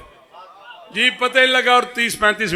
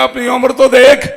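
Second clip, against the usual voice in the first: mock scolding; 5 semitones higher, 8 dB louder than usual. Rebuking passerby voiced.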